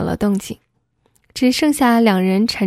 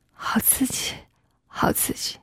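very hushed, airy reaction; barely voiced intimate murmur; breathless whisper; 11 dB quieter than usual. A narrator's reading. Husband responds in intimate scene.

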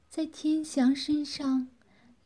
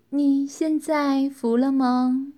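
slow, louder, drawn-out smiling question; smiling taunt; 2 semitones higher, 6 dB louder, slower than usual. A narrator's reading. Captor to her bound captive after releasing the hypnosis, smiling taunt. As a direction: savour the question with a smile, slowly. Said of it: "微笑道".

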